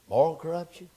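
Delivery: high, ringing sermon call; preaching at full force. Acted. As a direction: proclaim it like a headline of sin. Listing corruptions in full preach.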